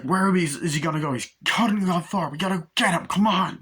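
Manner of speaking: rally the gang in a hurry; acted urgent shouting. Pursuers yelling in the chase.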